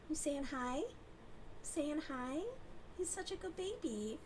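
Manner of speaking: high, soft pet talk. Real, not acted. To Gus on her lap, high soft baby talk.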